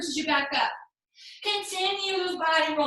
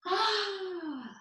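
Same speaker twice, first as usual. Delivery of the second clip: long, falling sigh; relieved sigh; 3 semitones higher, 6 dB quieter than usual. Real, not acted. Teacher resting in child's pose after the flow, long relieved sigh. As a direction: let out a big soft exhale.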